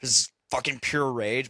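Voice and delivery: normal talking voice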